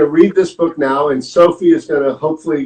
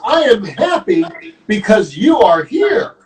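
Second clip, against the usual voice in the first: high, lilting happy declaration; glad declaration; 7 semitones higher than usual. Acted. Storyteller voices Gerald as he hugs Piggy, joyful.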